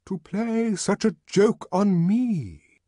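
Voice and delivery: high, loud, slow offended complaint; indignant. A narrator's reading. The arch songster complaining to the guests, loud indignant. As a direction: sound deeply affronted. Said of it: "the arch songster kept repeating".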